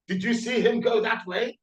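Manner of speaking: high put-on spy voice; bad German spy. Host mocking German spies, high put-on voice. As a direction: play a clumsy spy asking around. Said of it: "the Germans don't exactly make the best spies".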